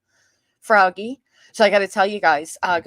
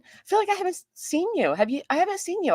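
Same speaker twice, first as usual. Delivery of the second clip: much higher, swooping greeting; playful high-pitched greeting; high sing-song; 12 semitones higher, faster than usual. Acted. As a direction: coo it up high to a missed friend. Sing-song greeting to a chat regular.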